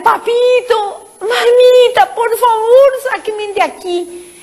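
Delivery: put-on a child crying out to her parents; sobbing cry to parents. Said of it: "Yo le empecé a gritar". (grito llorando a sus padres)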